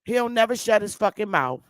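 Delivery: ordinary delivery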